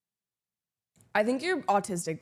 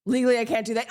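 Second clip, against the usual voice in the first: ordinary delivery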